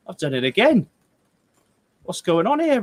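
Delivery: exasperated, pitch climbing high; real exasperation. Real, not acted. Streamer to Roy as the equipment fails again, exasperated. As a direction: let the frustration push the voice up.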